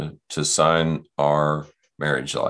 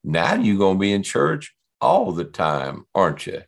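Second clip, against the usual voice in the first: put-on thick Southern accent; 3 semitones higher than usual. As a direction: imitate a Southern lady, voice lifting high. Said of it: "thick Southern accent, and she said".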